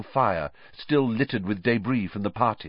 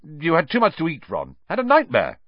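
high, condescending brush-off; dismissive; 5 semitones higher, 5 dB louder than usual. Acted. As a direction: wave off his little brother. Prefect dismisses brother.

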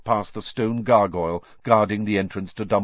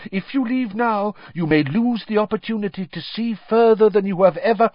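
Professor Trelawney; 12 semitones higher, 4 dB louder than usual. Acted. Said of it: "said Professor Trelawney".

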